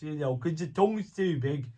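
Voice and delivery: ordinary talk, nothing marked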